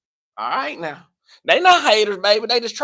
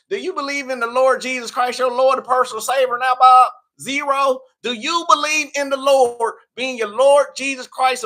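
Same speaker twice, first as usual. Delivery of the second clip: high, loud Southern preacher scolding; country preacher voice; 5 semitones higher, 4 dB louder than usual. Pretend. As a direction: preach it hard with a thick country accent. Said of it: "Y'all made me bring my country out".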